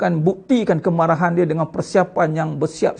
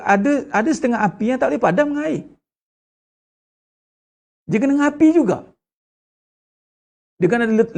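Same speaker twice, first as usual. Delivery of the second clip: fiery preaching; 4 semitones higher than usual. Acted. Preacher warns the congregation about hellfire, in full fiery preaching.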